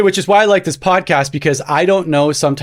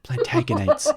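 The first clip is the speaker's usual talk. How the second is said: soft, tentative practice of PLANTAGENETS; practising PLANTAGENETS quietly to yourself; shadow pronouncing; 11 semitones higher, 8 dB quieter than usual. Shown as a demonstration. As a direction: mouth PLANTAGENETS under your breath. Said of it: "I was, like, literally shadow pronouncing".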